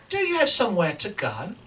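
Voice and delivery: put-on English accent, pointed question. Writer answers the impatient agent in character, English accent. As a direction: challenge him in a fake English accent. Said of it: "I'm speaking in an English accent".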